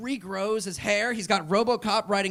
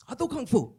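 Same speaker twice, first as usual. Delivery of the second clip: Jet Li voice; 8 semitones higher than usual. Retold or imitated.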